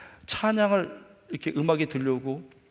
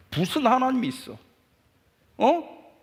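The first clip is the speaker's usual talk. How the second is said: inner defiant yell; 10 semitones higher, 4 dB louder than usual. Retold or imitated. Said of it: "막 소리를 속에서 지르면서".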